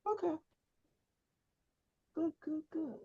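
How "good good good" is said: usual speaking voice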